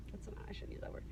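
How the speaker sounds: usual speaking voice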